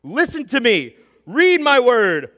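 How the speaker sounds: loud, high, slow commanding shout; God shouting. Retold or imitated. Imagined God shouting orders over our chaos, loud and slow. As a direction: boom the words over the chaos. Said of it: "come into the midst of our chaos and shout over it".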